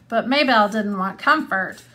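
ordinary talk, nothing marked

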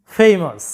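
ফেমাস as FAY-mus, long diphthong; correct pronunciation. Shown as a demonstration. Teacher introduces word four to students, correct pronunciation. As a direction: model ফেমাস with a gliding "ay", falling. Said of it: "সঠিক উচ্চারণটা হচ্ছে".

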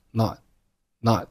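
"not" cut off with the t unreleased; swallowed t. Shown as a demonstration. Teacher showing lost plosion to learners, unreleased t in "not". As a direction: put the tongue in place for t in "not" without a burst. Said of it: "但是把声音给吞进去".

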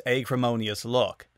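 usual speaking voice

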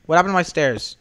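ordinary talk

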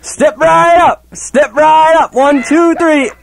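high, slow barker shout; carnival barker. Shown as a demonstration. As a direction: call the crowd like a carnival barker. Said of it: "I did a little something like this".

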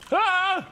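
high, loud fake yelp; mock scared yell. Showing what he would've said if scared, mock frightened yelp. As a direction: fake a sudden fright, loudly. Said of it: "would've said if I was scared".

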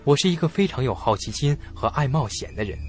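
usual speaking voice

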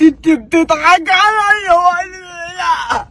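high, crying, accusing wail; mock crying wail. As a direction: wail it out like a hurt child. (عياط تمثيلي عالي ونبرة اتهام)

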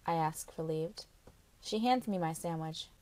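ordinary delivery, nothing marked

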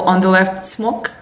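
ordinary delivery

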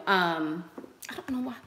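ordinary delivery

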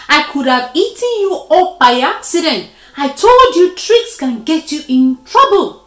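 loud, high-pitched telling-off; worked-up storybook reproach; scolding. Acted. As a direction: lecture a foolish friend at full voice. Dog scolds the spider.

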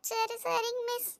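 sniffle out the words, heartbroken; drawn-out sobbing child; child crying. Acted. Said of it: "ஏன்டி இப்படி அழுதுகிட்டு இருக்க".

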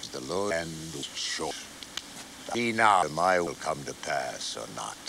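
the Lord speaking to Moses, low and distant. A narrator's reading. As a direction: speak as God from afar.

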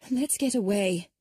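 Emma urging the family away from the crowd, sharply. A narrator's reading. Emma hurrying off from onlookers, said sharply. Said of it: "Emma said sharply".

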